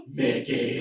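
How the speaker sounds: loud, starstruck cry of the name; overcome fan cry. Acted. Drunk fan spotting Mickey at Disney, overcome cry. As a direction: call out to your idol with a big, loud voice.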